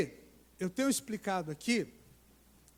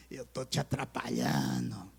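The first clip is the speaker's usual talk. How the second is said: demônio lento; 10 semitones lower than usual. Retold or imitated. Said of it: "o demônio".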